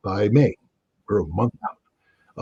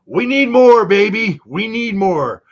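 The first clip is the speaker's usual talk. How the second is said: high, loud whiny begging; mock a greedy beggar demanding more; mocking needy voice; 10 semitones higher, 6 dB louder, slower than usual. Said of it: "Ukrainians go".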